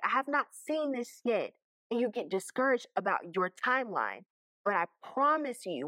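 normal talking voice

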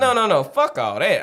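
loud protest. Real, not acted. Protesting the fan claim.

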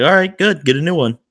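Jerry brushing off the O-line coach, lightly mocked; mock Jerry reply. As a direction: toss it off high like the boss is done. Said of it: "And he's like".

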